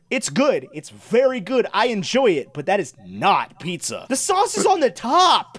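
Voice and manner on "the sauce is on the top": high, drawn-out indignant outburst; exasperated protest. Real, not acted. Arguing with friends about Chicago pizza, exasperated. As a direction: stretch it out, pitched way up in protest.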